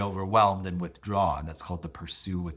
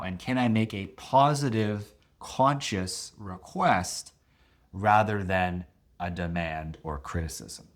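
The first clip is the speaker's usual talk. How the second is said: ordinary talk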